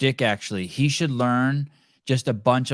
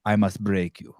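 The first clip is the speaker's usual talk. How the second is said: mock Drago; 4 semitones lower than usual. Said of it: "look over at him and be like".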